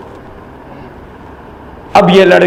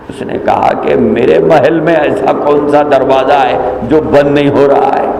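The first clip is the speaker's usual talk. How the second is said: normal talking voice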